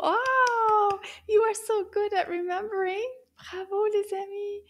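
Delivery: high, loud delighted praise; bright cheering for the class; excited praise. Acted. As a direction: gush over the kids' memory.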